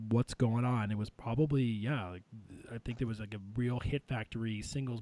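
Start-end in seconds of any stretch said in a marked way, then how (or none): none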